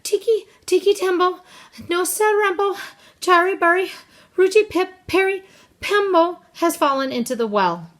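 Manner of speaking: high, loud name in breathless bursts; breathless little boy raising the alarm; panting child. A narrator's reading. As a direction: force out each chunk between breaths. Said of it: "he panted".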